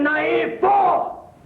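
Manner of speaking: angry shout. Acted. Heated quarrel, final insult.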